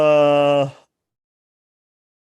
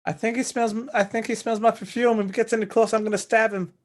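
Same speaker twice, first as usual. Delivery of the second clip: female character voice; 7 semitones higher than usual. Acted. Character voice, same as before.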